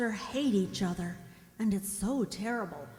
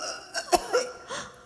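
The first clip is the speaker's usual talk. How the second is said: sharp gasp. Acted. Mother has an attack.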